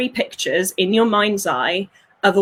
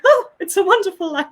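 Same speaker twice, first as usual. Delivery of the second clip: much higher, bubbling with laughter; laughing delight; 9 semitones higher than usual. Retold or imitated. Her present self, laughing about loving life. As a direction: sing it out through a laugh. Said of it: "I'm like".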